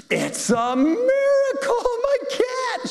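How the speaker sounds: very high, slow, squealing "It's a miracle"; shrieked joy. Retold or imitated. The cat owner shrieks to the pastor, very high and slow.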